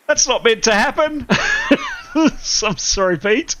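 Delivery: 1 dismayed laugh. Real, not acted. GM shocked at character death.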